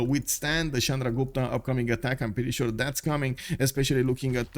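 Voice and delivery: normal talking voice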